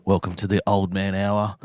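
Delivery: low, smooth radio host voice; late-night radio host. Pretend. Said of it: "I'm just gonna introduce this section".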